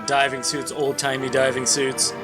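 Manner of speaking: ordinary delivery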